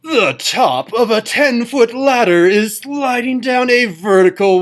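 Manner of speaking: loud, high, drawn-out announcer-like accent; hammed-up showy reading, high and booming; fun put-on accent. Shown as a demonstration. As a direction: read it out big and slow in a silly put-on accent. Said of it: "let's just do some fun accent".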